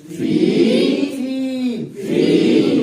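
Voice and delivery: teacher drawing out the vowel to show its length; drawn-out recitation. Shown as a demonstration. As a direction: stretch each फी over one alif length. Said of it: "तो देखिए पढ़ेंगे फी".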